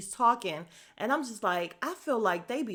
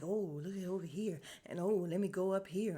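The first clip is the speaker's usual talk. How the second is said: soft imitation of self-chatter; quiet, mock private commentary; talking to herself; 8 dB quieter than usual. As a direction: drop the volume as if chatting to yourself. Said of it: "she's just talking to herself".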